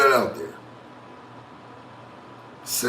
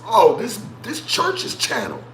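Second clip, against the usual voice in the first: mock realization; 8 semitones higher, slower than usual. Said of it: "I went back and I said".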